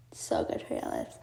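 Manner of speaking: a confiding whisper of the same line; whispered aside. Real, not acted. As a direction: breathe the tip out like a secret to the viewers. Repeats the tip as an aside.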